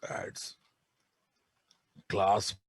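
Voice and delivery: slow, soft dictation for note-taking. Acted. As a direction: read it out word by word, slowly. Dictating notes slowly.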